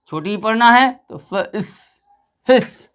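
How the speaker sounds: फिस with a short clipped i, not फाइस; short i sound. Shown as a demonstration. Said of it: "क्या पढ़ना है यहाँ पर". (फिस में छोटी ई बोलें)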